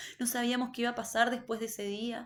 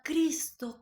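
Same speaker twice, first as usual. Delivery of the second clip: crying out; 6 semitones higher, 3 dB louder than usual. Retold or imitated. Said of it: "él iba gritando y gritaba como con un clamor".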